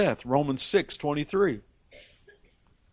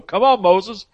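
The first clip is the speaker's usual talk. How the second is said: higher, louder pleading to Moses; pleading people; 5 semitones higher, 3 dB louder than usual. Retold or imitated. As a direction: beg Moses as the frightened crowd. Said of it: "then they're going to Moses and going".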